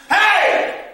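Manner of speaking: high startled shout; sharp yell. Acted. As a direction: bark it out suddenly. Sudden yell in narration.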